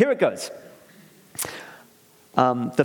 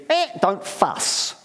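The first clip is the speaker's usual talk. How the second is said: slow, lightly higher parrot squawk; 5 semitones higher, much slower than usual. Acted. Family parrot telling him not to fuss, slow light squawk. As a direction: drag it out like a lazy parrot. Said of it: "a bigger one for me would be".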